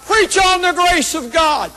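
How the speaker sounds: hollered out. Retold or imitated. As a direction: call it out from the pews. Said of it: "hollered out".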